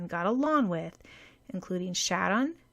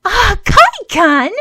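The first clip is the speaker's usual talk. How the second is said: high, loud, slow cry of horror; a girl horrified by her friend's cruelty; horrified exclamation; 12 semitones higher, 18 dB louder, slower than usual. A narrator's reading. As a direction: gasp out his name aghast. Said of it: "Sakura exclaimed in horror".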